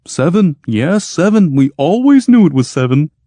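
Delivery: high, loud eager yes-man; eager agreement. Acted. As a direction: agree far too eagerly. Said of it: "Clopasius eagerly agreed".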